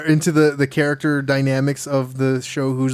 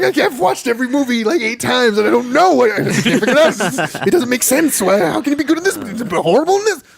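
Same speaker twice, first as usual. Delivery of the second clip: much higher, strained frantic voice; high baffled rant about Nick Cage; frantic Abed; 9 semitones higher, 4 dB louder than usual. Retold or imitated. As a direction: play him losing his mind over it. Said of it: "He's like".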